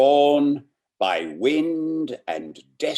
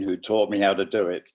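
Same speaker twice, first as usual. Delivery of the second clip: ordinary delivery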